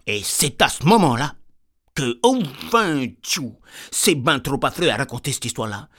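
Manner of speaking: ordinary talk, nothing marked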